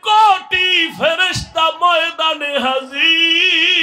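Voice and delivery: high, loud chanted declaration; exultant proclamation. Retold or imitated. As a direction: proclaim a wonder at full force, stretching the endings. Prophet proclaims angels' arrival. (উঁচু গলায় সুরেলা ঘোষণা)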